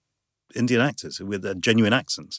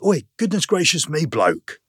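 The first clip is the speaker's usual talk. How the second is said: loud, raised-pitch pub heckle; imitated aggressive heckle; 8 semitones higher, 4 dB louder than usual. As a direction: call out like a rowdy stranger. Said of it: "aggressive way".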